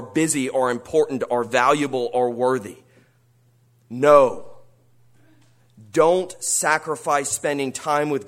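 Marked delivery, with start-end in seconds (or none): 3.9-4.5 emphatic no. Acted. Preacher rejects the world's doctrine.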